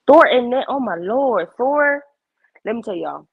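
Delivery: normal talking voice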